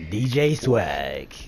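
slow, drawn-out movie-trailer announcer. Pretend. Gamer introducing a teammate for a montage, movie voice. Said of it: "movie voice".

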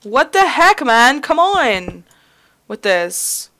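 high, loud frustrated cry; exasperated outburst. Real, not acted. Player yelling at misplaced blocks, exasperated.

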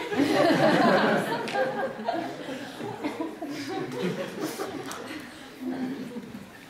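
loud, high burst of laughter; real laugh. Real, not acted. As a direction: laugh hard at your own joke. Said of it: "Just think about it".

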